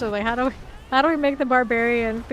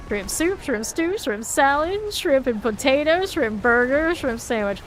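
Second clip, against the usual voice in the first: put-on higher, louder Southern accent with rising ends; Southern accent shrimp list; 4 semitones higher, 5 dB louder than usual. Gamer continues the shrimp list, in a raised Southern accent. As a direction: keep listing shrimp in a Southern accent, lifting each one. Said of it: "that Southern accent's gonna be extra pronounced".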